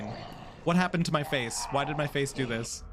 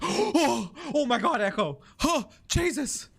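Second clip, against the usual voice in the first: acted surprise; 8 semitones higher than usual. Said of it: "Did, did I sell it?".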